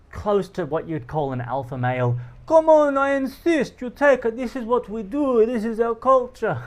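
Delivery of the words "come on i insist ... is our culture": put-on loud, high Montenegrin accent, pushy. Host insisting I take the shot, loud Montenegrin accent. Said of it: "I'm trying to do a Montenegrin accent".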